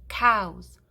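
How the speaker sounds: ordinary delivery, nothing marked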